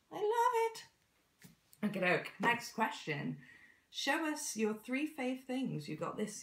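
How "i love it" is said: squeaky, much higher and louder; high squeal. Shown as a demonstration.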